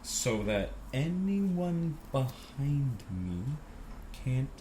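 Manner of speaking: very slow, softened mock drawl with long gaps; zombie-slow mimicry. Pedestrian blocking everyone behind, mocking zombie-slow drawl. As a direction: drag every word out like a sluggish zombie. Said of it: "like a zombie would walk, very slowly".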